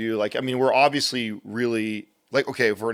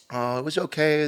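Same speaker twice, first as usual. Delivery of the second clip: imitating his young son; 6 semitones higher than usual. Father voices his son.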